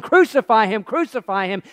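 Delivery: loud cry easing on the repeat; crowd yelling. Acted. Prisoner overhears the crowd's verdict, yelling. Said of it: "hear the crowd yelling".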